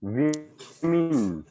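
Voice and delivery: वीमेन said slowly as "wee-min", first vowel long ee; teacher models वीमेन, drawn out, "wee-min"; women as wee-min. Shown as a demonstration. Teacher shows the class the correct word, slow "wee-min". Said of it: "इसको बोलेंगे वीमेन".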